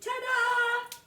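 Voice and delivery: high, loud sung ta-da; shouted ta-da. Acted. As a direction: sing out ta-da in triumph. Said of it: "Chad shouted".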